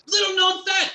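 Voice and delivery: loud, high burst of excitement; excited exclamation. Acted. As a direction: blurt it out like big news. Announcing a dramatic claim.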